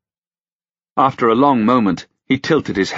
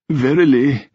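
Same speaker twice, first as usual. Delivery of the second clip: put-on tearful old king; 7 semitones higher, 3 dB louder than usual. A narrator's reading. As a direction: let the second one sink into a sniff. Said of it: "he gave a deep sniff".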